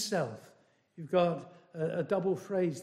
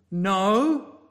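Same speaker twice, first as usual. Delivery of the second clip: ordinary talk, nothing marked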